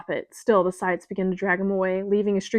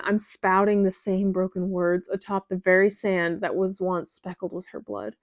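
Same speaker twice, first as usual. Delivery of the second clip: ordinary delivery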